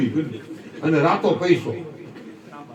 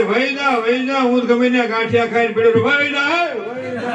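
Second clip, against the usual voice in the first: high-pitched nagging go away; mildly hammed-up wife telling husband to leave; wife's mock scolding; 7 semitones higher than usual. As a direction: shoo him off like an annoyed wife. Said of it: "ઘરવાળી કહે".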